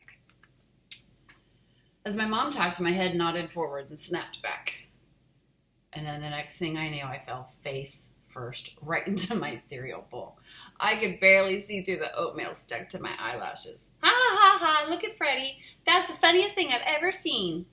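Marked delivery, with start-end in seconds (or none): 0.0-0.2 hushed pointing whisper. Real, not acted. Reader whispering asides. 14.0-17.6 high loud mocking laugh; screaming with laughter. A narrator's reading. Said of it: "Susie screamed with laughter".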